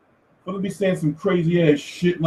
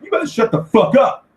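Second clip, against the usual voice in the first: retorting; 8 semitones higher than usual. Retold or imitated. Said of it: "they be like".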